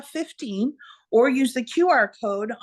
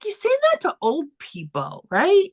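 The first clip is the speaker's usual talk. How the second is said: indignant; 6 semitones higher than usual. Retold or imitated. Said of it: "I was like".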